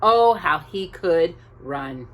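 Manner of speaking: ordinary delivery, nothing marked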